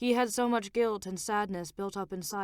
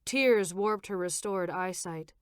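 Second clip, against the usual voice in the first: ordinary delivery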